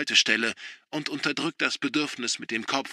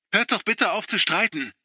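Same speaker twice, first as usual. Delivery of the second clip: high, louder desperate plea; pleading; 9 semitones higher, 6 dB louder than usual. A narrator's reading. Sister begging father and brother to stop, pleading. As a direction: beg them to stop.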